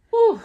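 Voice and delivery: high, loud sigh of relief; relieved whew. Real, not acted. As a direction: blow out the tension. Relief after hearing of seats.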